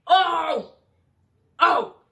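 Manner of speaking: loud, high cry of "oh"; sudden high-pitched holler; loud outburst. Real, not acted. Sudden loud outburst mid-story.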